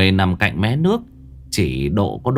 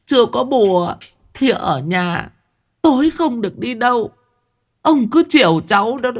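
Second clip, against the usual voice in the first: much higher, whimpering grandma scolding; tearful old woman; 13 semitones higher than usual. A narrator's reading. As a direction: sob and scold her husband. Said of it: "Bà sụt sùi". (bà già sụt sùi trách chồng, giọng cao)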